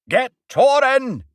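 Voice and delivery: high, loud, slow cry. A narrator's reading. Governor crying out to his team, high slow shout. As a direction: stretch the shout out over the noise. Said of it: "cried the Governor".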